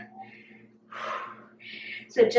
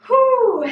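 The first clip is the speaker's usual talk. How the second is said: winded whew; 10 semitones higher, 4 dB louder than usual. Real, not acted. Counting reps, exhales.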